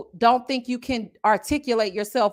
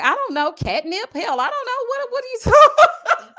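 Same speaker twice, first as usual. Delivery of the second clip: rising higher and louder into laughing disbelief; baffled; 12 semitones higher, 5 dB louder than usual. Retold or imitated. Reacting to confusing comments, baffled and laughing. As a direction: pitch climbs up and up, then breaks into a laugh. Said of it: "I'm like".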